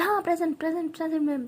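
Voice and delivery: jolted awake, loud and high; startled present. Acted. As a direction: blurt present ma'am as you jump up. Startled awake, answering roll. (चौंककर ज़ोर से हाज़िरी)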